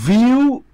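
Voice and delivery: long pauses between the three syllables of beautiful. Shown as a demonstration. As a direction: call out each syllable of beautiful separately. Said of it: "কয়টা syllable আছে".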